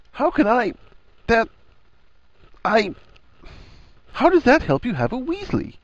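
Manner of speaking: high-pitched, stop-start bewilderment; halting, confused question; flustered. A narrator's reading. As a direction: break off and restart, baffled. Harry flustered, cannot frame question.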